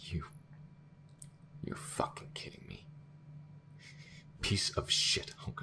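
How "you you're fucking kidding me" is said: soft, airless curse of disbelief; hushed disbelief. Acted. Crew member hearing the AI admit it, hushed disbelief. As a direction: say it under your breath, appalled.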